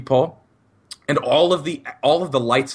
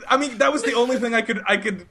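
high, squealing through laughter; slow words broken by real laughs; helpless laughter; 13 semitones higher, slower than usual. Real, not acted. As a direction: struggle to speak while cracking up. Cracking up at own embarrassing line.

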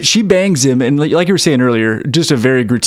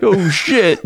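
laughing oh shit; 6 semitones higher than usual. Retold or imitated. Characters' reaction, laughing.

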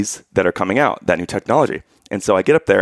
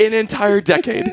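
squeaky high voice; 11 semitones higher than usual. Pretend. Joking to cohost that his voice never deepened, high squeak. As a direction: jump way up into a thin, boyish squeak.

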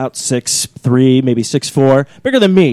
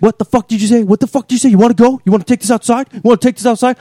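angry bar owner; 7 semitones higher than usual. Retold or imitated. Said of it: "he's like".

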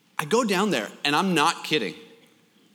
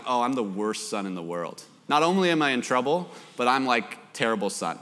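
low, downcast retold thought; mock dejection; 6 semitones lower than usual. Boy to himself as worst son, mock glum. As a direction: sink lower in mild self-reproach. Retelling his guilt as a child.

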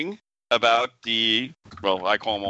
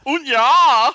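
mock German accent; 14 semitones higher, 9 dB louder than usual. Mock foreign exclamation.

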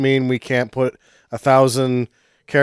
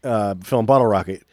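ordinary talk, nothing marked

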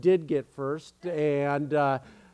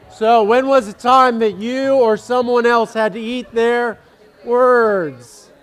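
higher, louder question to the hall; pitched up and loud for the whole room; louder repeat; 5 semitones higher, 8 dB louder than usual. Real, not acted. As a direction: project it to the back row.

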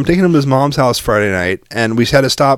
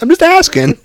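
very high, louder defensive whine; imitated kid's protest; 5 dB louder than usual. Son defends himself to dad, imitated high protest. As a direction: mimic a kid protesting innocence, pitched way up.

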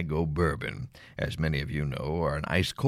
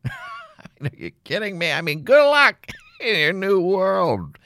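incredulous laughing; 14 semitones higher, 6 dB louder, slower than usual. Acted.

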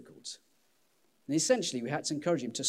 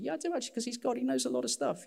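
high jokey retort; 11 semitones higher, faster than usual. Acted. Answering for God, jokey.

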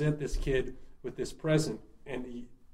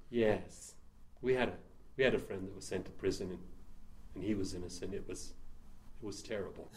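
soft, lower, halting retold confession; imitated sombre confession; 6 semitones lower, 10 dB quieter than usual. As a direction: act out her hushed, sorrowful admission. Voicing the woman's sombre confession.

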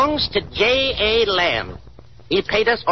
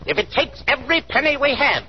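usual speaking voice

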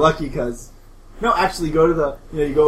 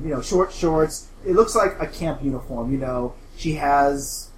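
ordinary talk, nothing marked

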